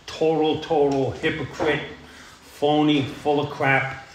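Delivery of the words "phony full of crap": ordinary talk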